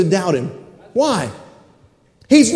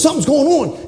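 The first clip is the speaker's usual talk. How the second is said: higher-pitched acted alarm; startled alert; 6 semitones higher than usual. Brother Shank jumping up at the rumbling, acted alarm. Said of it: "Brother Shank jumps up".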